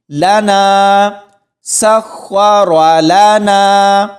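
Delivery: لنا سخر لنا very slowly, final naa held long; stretched madd. Shown as a demonstration. Teacher demonstrating the alif's length, slow sustained recitation. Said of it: "ایک الف کی مقدار کھینچ کر پڑھیں گے".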